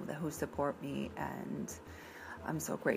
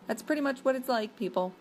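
excited high emphasis; 9 semitones higher, 4 dB louder, faster than usual. Real, not acted. Admitting Boston stereotype.

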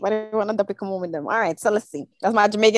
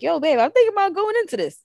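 much higher-pitched pitch to his partner; boyfriend voice; 9 semitones higher than usual. Acted. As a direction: play a guy floating an idea to his babe, voice lifted high. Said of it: "that guy is like".

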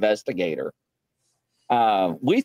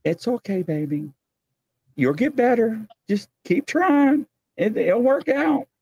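mock-tender encouragement to a shy creature; coaxing the Bigfoot; 7 semitones higher than usual.

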